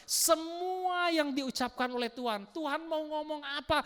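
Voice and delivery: ordinary talk, nothing marked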